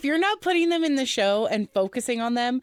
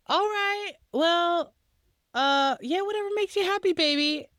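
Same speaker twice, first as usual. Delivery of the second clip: much higher, sing-song humoring tone; indulgent girlfriend; 8 semitones higher than usual. Retold or imitated. Girlfriend humoring boyfriend hiding in a cupboard, high sing-song indulgence. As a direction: humor him like a patient girlfriend. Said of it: "K B is like".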